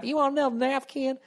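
lightly voiced friendly southern lady; mock sweet southern woman. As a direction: coo it like a doting waitress.

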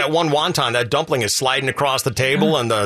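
normal talking voice

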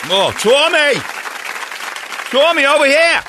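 hammed-up Boston accent calling Tommy; Boston fan calling out. Mocking Boston fans calling Tommy.

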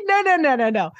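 high, firm no no no; emphatic mock scolding. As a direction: wave the idea away hard. Scolding the doctor again.

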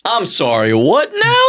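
baffled double-take leaping high on "what now"; incredulous. Real, not acted. Asking co-host to repeat an absurd ball suggestion, incredulous. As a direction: voice shoots up in disbelief. Startled by co-host's odd suggestion.

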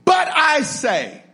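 "But I say" stretched out high; slow, high proclamation of "But I say"; big emphatic BUT. Shown as a demonstration. Preacher announcing the turning point, big emphatic "But". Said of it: "so it's a big but".

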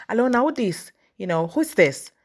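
curt, high-pitched hello with falling end; brusque imitation of a rude pickup; rude phone answer. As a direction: answer the phone as if annoyed at the caller. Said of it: "don't be rude on the phone".

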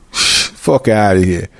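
usual speaking voice

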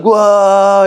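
high, loud mock gasp of wonder; amazed kids. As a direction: imitate a crowd of awestruck kids.

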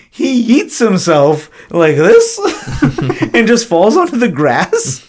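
high, giggly retelling broken by laughs; laughing while telling. Real, not acted. Host recalls the absurd movie fall to co-hosts, laughing. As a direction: crack up mid-sentence, pitch jumping well up. Recounting an unintentionally funny scene.

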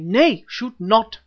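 loud, slow commanding shout. A narrator's reading. Babemba shouting the archers down, loud and slow. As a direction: bellow to stop the archers. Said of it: "shouted Babemba".

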